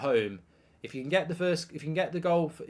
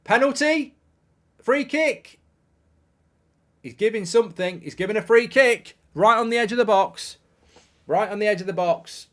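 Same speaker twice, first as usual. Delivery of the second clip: raised, loud live commentary; excited match call; 7 semitones higher, 8 dB louder than usual. Real, not acted. Commentator watching a free kick given on the edge, excited. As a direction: call the decision out with sudden urgency.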